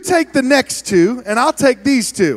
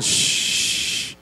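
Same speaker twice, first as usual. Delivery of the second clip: slow, unvoiced listen and shush; quiet them down; hushing; 9 dB quieter than usual. Real, not acted. Hushing the crowd.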